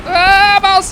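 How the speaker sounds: high loud angry outburst; frustrated shout. Real, not acted. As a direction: blurt it out in frustration. Getting hit.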